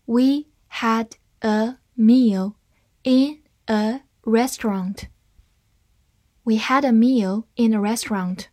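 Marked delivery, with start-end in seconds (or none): 0.0-5.1 slow, word by word; deliberate teaching pace for learners to follow; slow reading. Shown as a demonstration. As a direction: leave a gap after every word. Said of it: "慢慢来读".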